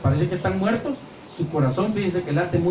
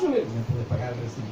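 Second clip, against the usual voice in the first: sly request; 7 semitones lower, 6 dB quieter than usual. Acted. Line-cutter asking favour.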